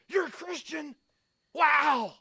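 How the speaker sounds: high, bright squeal of delight on meeting a fellow believer; excited. Retold or imitated. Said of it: "You're so excited".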